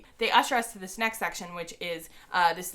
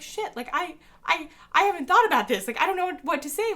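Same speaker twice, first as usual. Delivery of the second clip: panicked; 10 semitones higher, 5 dB louder than usual. Retold or imitated. Panicking self-quote, first meeting nerves.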